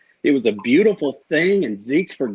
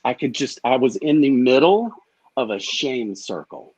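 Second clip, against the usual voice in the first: ordinary talk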